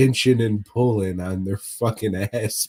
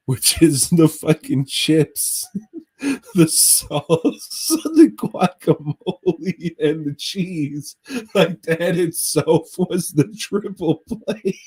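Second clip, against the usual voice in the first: laughing; 7 semitones higher, 4 dB louder, slower than usual. Real, not acted. Cracking up retelling the order.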